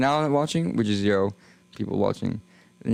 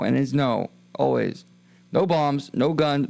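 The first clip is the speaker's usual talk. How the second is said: ordinary talk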